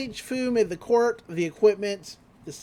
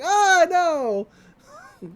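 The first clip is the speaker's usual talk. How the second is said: high, loud startled cry of alarm; panicked yelp; 10 semitones higher, 5 dB louder than usual. Retold or imitated. Dodging a fireball, a loud high panicked yelp. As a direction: yelp as the fireball comes at you. Ando dodging a flaming ball.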